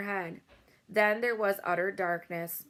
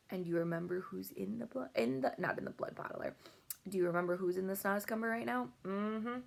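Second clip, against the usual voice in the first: soft aside; 7 dB quieter, faster than usual. Real, not acted. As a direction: drop the voice to check in with the listener. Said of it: "reader aside to child listener".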